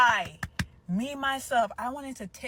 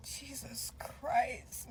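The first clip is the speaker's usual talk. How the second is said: slow, drawn-out whisper; hushed, lingering exclamation; awestruck whisper; 3 semitones higher, 8 dB quieter, much slower than usual. Real, not acted. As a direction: whisper it slowly in disbelief. Swooning over her good looks.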